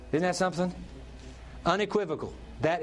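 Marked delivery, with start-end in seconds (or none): none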